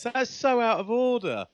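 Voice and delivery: ordinary talk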